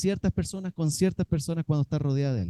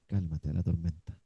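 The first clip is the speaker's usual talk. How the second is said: calm command; 9 semitones lower, 6 dB quieter than usual. Acted. Jesus speaking to the storm, low and soft. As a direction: hush it gently and low. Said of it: "cuando Jesús dice".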